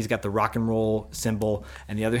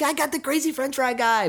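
put-on excited kid; 13 semitones higher than usual. Kid bragging about yearbook.

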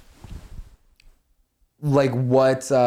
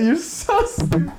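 high, slow mocking voice; silly high voice; slower than usual. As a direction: sing it out high and slow, teasing. Mock insult in odd voice.

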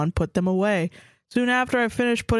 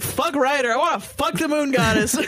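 laughing; 6 semitones higher than usual. Pretend. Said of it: "I'd be like".

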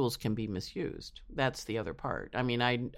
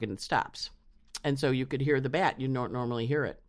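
ordinary talk

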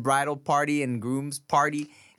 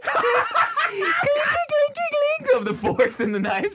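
imitating clinking. Imitating glasses clinking at wedding.

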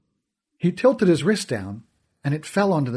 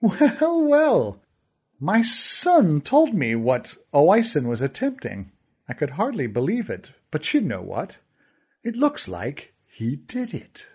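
smug villain; 3 semitones higher than usual. Acted. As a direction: purr the lesson with a smile. Kealty sees Oisin's success.

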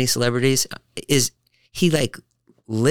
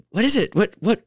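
mild distracted mom; 12 semitones higher than usual.

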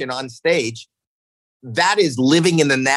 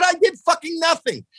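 exasperated; 13 semitones higher than usual. Retold or imitated. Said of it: "I said".

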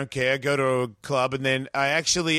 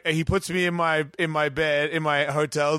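ordinary delivery

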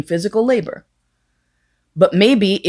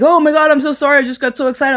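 high, loud imitation of gushing apology; flustered mock apology; 8 semitones higher, 3 dB louder, faster than usual. Teller imitating the man apologising for finishing early, high and flustered. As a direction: blurt it high, imitating someone embarrassed and overexcited.

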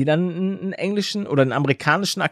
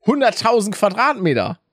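pretend rich person; 6 semitones higher than usual. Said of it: "ich bin ja noch in dem Szenario".

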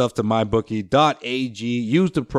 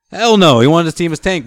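emphatic outburst; 6 semitones higher, 7 dB louder than usual. Real, not acted. Emphatic rebuttal about Danny Ainge.

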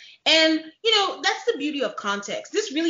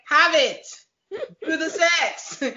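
blunt Clara impression; 6 semitones higher, 4 dB louder, slower than usual. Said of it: "Clara is like".

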